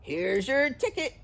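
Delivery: gruff bear gatekeeper. A narrator's reading. Bear giving the children tickets, gruff. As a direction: hand it over in a gruff put-on voice. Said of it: "the gruff voice said".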